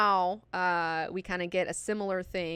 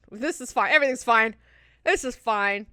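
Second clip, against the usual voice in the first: louder, higher, strained self-reassurance; hammed-up forced reassurance, building louder; mock frantic insistence; 3 semitones higher, 7 dB louder, slower than usual. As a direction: insist on it loudly, acting close to cracking. Acting Alia insisting while overwhelmed.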